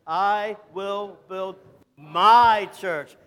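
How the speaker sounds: slow down, pause, then punch "my"; stress on "my". Shown as a demonstration. Preacher leading congregation in Jesus' words, stress on "my". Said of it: "we're gonna emphasize each word different".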